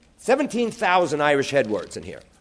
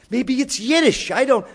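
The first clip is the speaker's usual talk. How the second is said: mother brushing off; 10 semitones higher, 5 dB louder than usual. Retold or imitated. Mother voiced in story.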